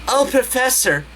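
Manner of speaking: high, hammed-up moaning call; mock moaning. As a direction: ham up a lustful moan. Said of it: "with a moaning, lustful voice".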